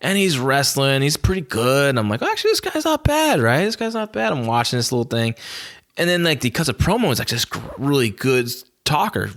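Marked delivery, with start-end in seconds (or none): 2.2-4.3 high, surprised and impressed; a much higher voice, newly impressed; impressed surprise. Retold or imitated. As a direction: pleasantly caught off guard. Retold impressed reaction, pitch jump.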